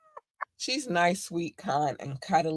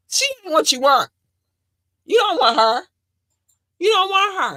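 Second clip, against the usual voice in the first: higher and louder, insistent warning; emphatic warning; 13 semitones higher, 8 dB louder than usual. Real, not acted. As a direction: drill it into them. Warning listeners.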